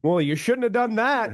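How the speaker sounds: high, put-on voice of a tut-tutting friend; mock scolding friend. Retelling the friend's reply to the cat story, in a high scolding voice. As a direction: tell her off in a high, prissy voice.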